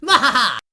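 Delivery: high, loud evil cackle of a cartoon villain. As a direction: cackle in triumph like a cartoon baddie. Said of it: "captured by the evil alarm clock".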